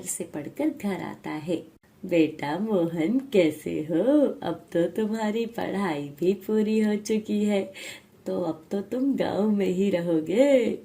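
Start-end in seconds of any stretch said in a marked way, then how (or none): 2.0-10.8 village headman greeting his son; fond village father. Acted.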